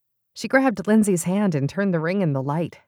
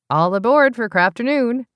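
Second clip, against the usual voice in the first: cheery call; 7 semitones higher, 5 dB louder than usual. Acted. Lindsay calls a greeting.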